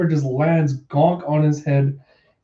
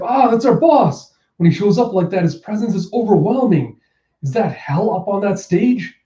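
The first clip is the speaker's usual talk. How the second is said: high-pitched, excited awe; awestruck underling; 6 semitones higher than usual. Acted. Gifter awed by the boss onstage, excited and high. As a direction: gush in a raised pitch about the boss.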